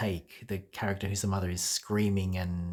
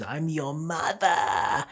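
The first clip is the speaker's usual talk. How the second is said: high, drawn-out shriek of an offended mother; man in drag screaming as mother; 7 semitones higher, 5 dB louder, much slower than usual. Retold or imitated. Sketch mother screaming at her son over breakfast, shrill yell. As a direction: shriek it like a furious drag mum. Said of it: "it goes".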